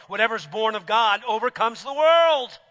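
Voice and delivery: high, loud preaching build; full-force preaching. Acted. Preacher challenging the congregation, loud and rising.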